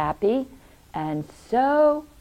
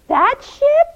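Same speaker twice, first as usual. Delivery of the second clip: very high, loud, rising question; high-pitched disbelieving voice; incredulous question; 6 dB louder than usual. Acted. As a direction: ask in utter amazement. Nora asks in disbelief.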